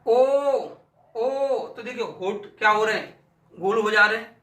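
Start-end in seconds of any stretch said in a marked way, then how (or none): 0.1-1.7 ओ held with rounded lips; demonstrating o sound. Shown as a demonstration. Teacher sounding out ओ for the class. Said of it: "कौन समझते हैं इनको".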